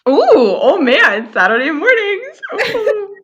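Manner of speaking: high, drawn-out delighted ooh; excited squeal. Real, not acted. Cohost squealing with glee as her dream segment starts. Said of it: "you should have just saw her face".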